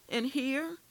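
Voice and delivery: high, loud shocked question; shocked prisoner gasps at his plan; gasping. A narrator's reading. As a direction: gasp it out in alarm. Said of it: "I gasped".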